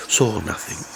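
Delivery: plain reading voice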